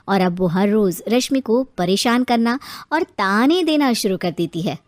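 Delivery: usual speaking voice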